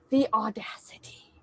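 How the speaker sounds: breathy whispered outrage; indignant whisper. Real, not acted. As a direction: hiss it under your breath. Indignant aside at the film.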